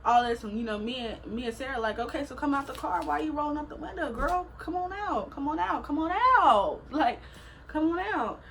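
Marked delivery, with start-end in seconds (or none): 2.0-8.4 high, sing-song goading to come out; taunting call. Retold or imitated. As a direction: dare her out of the car. Taunting girl locked in car.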